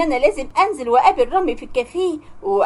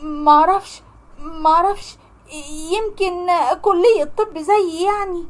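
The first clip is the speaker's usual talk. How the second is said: nervous; 4 semitones higher, slower than usual. Acted. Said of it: "اتوترت جدًا وبقيت اعرق".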